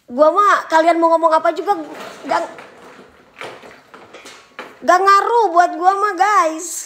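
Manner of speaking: high, loud, emphatic dismissal; loud defiance. Real, not acted. Streamer telling hecklers they have no effect, loud defiance. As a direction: brush the hecklers off at high volume.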